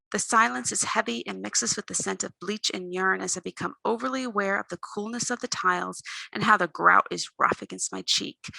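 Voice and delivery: usual speaking voice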